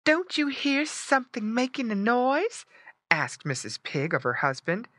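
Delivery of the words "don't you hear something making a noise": high-voiced mother pig asking; higher, questioning mama pig voice. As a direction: put on a high voice for Missus Pig.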